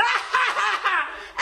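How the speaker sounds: loud, high mad laugh; crazed cackle. Retold or imitated. Woman scaring the driver as a joke, mad cackle. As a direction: cackle like one possessed. Cackle ending the mock outburst.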